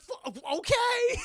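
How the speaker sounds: high, loud, slow flustered okay; startled okay. Retold or imitated. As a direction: blurt it out, rattled. Reluctant agreement in panic.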